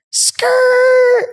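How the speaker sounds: high, loud imitated tire screech. Pickup truck skidding up on the group, mimicked screech. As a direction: squeal it like brakes locking up.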